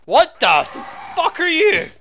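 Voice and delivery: shocked yelp at a monster; startled. Real, not acted. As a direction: caught off guard.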